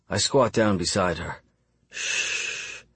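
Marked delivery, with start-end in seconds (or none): 1.9-2.8 soft, voiceless, drawn-out shush; whispered shush. A narrator's reading. Squatting beside a feverish patient to calm her, whispered shush. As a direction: hush them in a whisper. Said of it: "I whisper".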